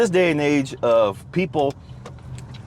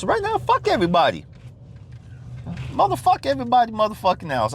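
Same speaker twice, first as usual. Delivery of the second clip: angry outburst; 10 semitones higher than usual. Real, not acted. Venting to listeners about pleasing everyone, fed-up outburst. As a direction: pitch jumps way up, cursing everyone out.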